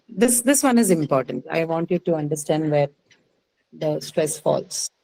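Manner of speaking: usual speaking voice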